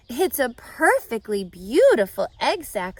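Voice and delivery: loud delighted praise. A narrator's reading. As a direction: gush with delight. Wilbur delighted.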